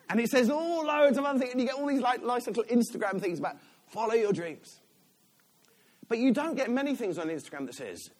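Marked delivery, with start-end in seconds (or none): none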